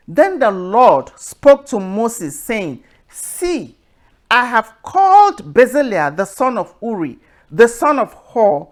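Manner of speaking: loud, slow, solemn Bible reading; measured pulpit reading of Exodus; scripture reading. Acted. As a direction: proclaim the verses to the congregation. Reads Exodus passage aloud.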